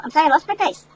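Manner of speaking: ordinary delivery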